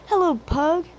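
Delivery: high cheerful engine call; whistled greeting. A narrator's reading. Percy greets his passing friend, lightly voiced high call. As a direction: toot a bright hello across the line. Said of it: "whistled Percy".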